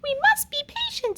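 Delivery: high, calm queen voice; patient queen. Acted. Queen reassuring the prince, high and calm. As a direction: settle everyone down gently. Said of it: "said the queen".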